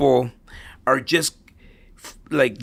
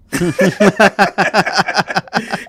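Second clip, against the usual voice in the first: a big burst of real laughter, higher and louder; 7 semitones higher, 7 dB louder than usual. Real, not acted. Father laughs at daughter's working sucks. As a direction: let out a loud, high laugh.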